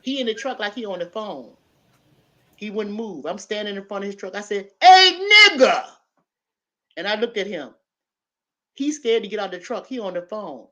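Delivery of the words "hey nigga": loud, high, slow call. Retold or imitated. Standing before the man's truck, slow loud high call. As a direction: draw it out and yell it at him. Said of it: "just like I'm telling you".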